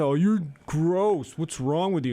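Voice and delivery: slow, drawn-out disgusted scolding; mock disgust. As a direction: play grossed out, stretch it. Acting disgusted partner.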